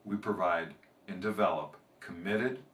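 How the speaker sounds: lightly voiced company tagline; ad read. Trainer closes the joke with a company pitch, ad read. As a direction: sign off the commercial, measured.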